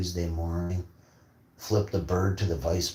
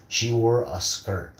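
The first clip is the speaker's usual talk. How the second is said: ordinary talk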